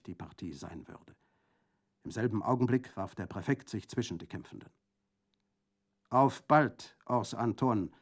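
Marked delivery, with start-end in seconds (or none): 6.1-7.9 called parting threat; calling out. A narrator's reading. Enemy retreating through the door, calling out. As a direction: toss it back loudly while leaving. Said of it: "rief Orlanduccio".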